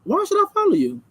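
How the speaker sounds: incredulous retort. Pretend. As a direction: toss the question right back at him. Retorting to imagined husband.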